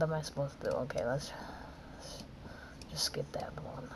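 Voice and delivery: unvoiced whisper to himself; whispered aside. Real, not acted. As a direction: drop to a muttered whisper. Muttering to self.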